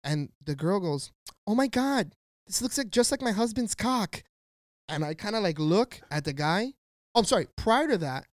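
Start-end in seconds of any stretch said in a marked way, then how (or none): 1.4-4.2 higher-pitched mock exclamation; girl's exclamation. Girl exclaiming to her husband in the store, higher-pitched exclamation.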